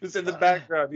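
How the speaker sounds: usual speaking voice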